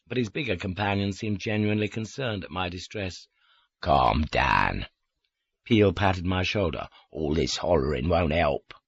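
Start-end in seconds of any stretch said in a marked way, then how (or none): 3.8-4.9 lower, gentle soothing voice; low, kindly calm. A narrator's reading. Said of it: "like a kindly parent soothing". 7.1-8.7 lower, gentle parental voice; low, kindly calm; kindly soothing. A narrator's reading. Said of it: "like a kindly parent soothing".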